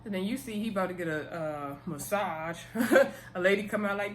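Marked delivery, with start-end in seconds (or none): 2.6-3.3 short rising laugh, louder; a bright burst of laughter; amused laugh. Real, not acted. Recapping Dana's massage, she laughs out loud.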